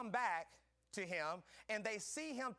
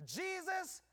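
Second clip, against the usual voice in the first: louder, higher shouting sermon; preaching at full force; 7 semitones higher, 5 dB louder than usual. Acted. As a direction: build to a shouting climax. Preaching climax.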